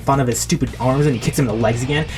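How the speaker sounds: rushing, excited action narration; run-on battle play-by-play; hyped-up storytelling. A narrator's reading. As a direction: pile it on like an overexcited kid.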